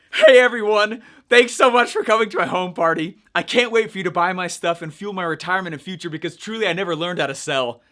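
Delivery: loud, gushing fake host greeting, hammed up; over-the-top party host. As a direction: oversell the welcome like a cheesy MLM host. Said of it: "I'm going over the top in the video".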